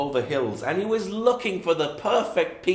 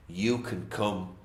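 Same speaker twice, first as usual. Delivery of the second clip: gentle invitation; 8 semitones lower, 5 dB quieter than usual. A narrator's reading. Closing moral, gentle invitation.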